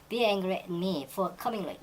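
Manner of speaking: very slow, careful textbook read; slow example reading. Shown as a demonstration. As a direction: lay out every word slowly for learners. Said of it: "teacher repeats example". (천천히 읽는 예문)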